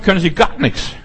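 normal talking voice

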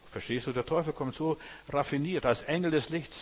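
normal talking voice

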